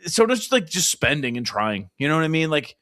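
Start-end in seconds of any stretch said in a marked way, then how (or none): none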